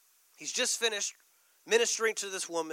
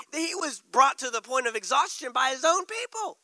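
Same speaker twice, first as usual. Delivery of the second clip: ordinary talk, nothing marked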